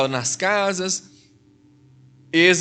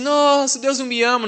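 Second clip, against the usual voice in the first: mock complaint; 6 semitones higher than usual. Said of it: "você fala assim".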